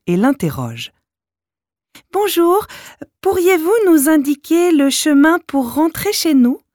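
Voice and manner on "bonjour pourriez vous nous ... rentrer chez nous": put-on child voice. A narrator's reading. Children politely ask.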